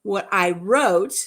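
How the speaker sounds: ordinary delivery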